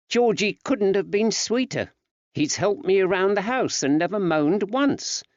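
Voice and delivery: lightly voiced wife, pleased; woman's voice. Betsy praising Georgie.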